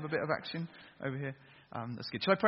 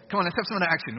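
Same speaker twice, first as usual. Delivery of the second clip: ordinary talk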